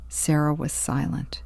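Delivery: usual speaking voice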